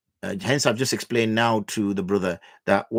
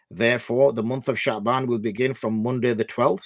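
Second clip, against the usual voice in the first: ordinary delivery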